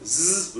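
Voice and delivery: Z as a held buzzing zzz; Z said as a pure consonant sound; letter sound Z. Shown as a demonstration. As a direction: buzz the sound of Z, not its name. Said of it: "spell the sound Z".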